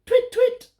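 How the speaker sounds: very high owl twitter; little owl twit. Acted. Olive the owl calling out twit. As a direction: chirp it like a tiny owl. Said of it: "she went".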